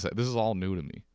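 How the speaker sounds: normal talking voice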